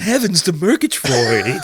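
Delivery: slow, high cartoon-cat voice; high, hammed-up catchphrase with a laugh; Snaggletooth impression. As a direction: draw out a theatrical cartoon exclamation. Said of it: "that Snaggletooth guy".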